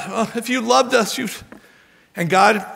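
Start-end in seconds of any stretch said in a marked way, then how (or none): 0.1-1.3 higher-pitched mock whine; crying out to God. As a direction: plead with a reproachful whine. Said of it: "they're crying out to God".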